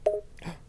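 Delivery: sharp, high intake of breath; startled gasp. Pretend. User finding an intruder in the Tardis, startled gasp. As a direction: gasp in pretend alarm. Sudden discovery in the roleplay.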